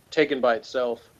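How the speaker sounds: usual speaking voice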